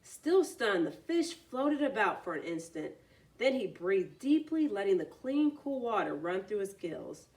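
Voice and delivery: plain reading voice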